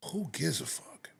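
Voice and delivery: hushed, slow, dismissive whisper; whispered aside. Real, not acted. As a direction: drop to a whisper for the curse. Dismissive aside mid rant.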